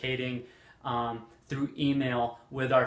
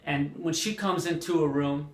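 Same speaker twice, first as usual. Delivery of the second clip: normal talking voice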